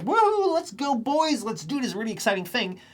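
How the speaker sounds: mock hype cheer. Streamer mocking his own forced hype, high and hammed-up. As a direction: pump up the boys like a fake hype man. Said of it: "I don't feel like".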